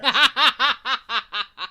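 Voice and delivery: loud laugh at her own flubbed take; real burst of laughter. Real, not acted. Host laughing at her own bad take, bursting out. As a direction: crack up at the mistake.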